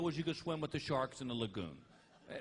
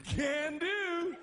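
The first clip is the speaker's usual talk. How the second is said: high, loud, dopey reply; stoned agreement; 11 semitones higher, 4 dB louder than usual. Retold or imitated. Husband told to stare at the sand, high dopey answer. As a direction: chirp it like a happy stoner. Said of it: "I'm like".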